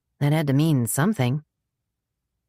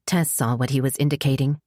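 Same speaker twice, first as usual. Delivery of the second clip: plain reading voice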